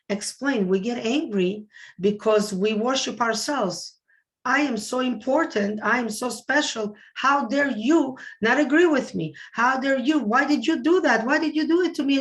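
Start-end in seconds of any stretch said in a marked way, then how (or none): 4.5-12.2 hammed-up how-dare-you indignation; mock self-important rage. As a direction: act out the ego that feels wronged. Voicing self-important angry ego.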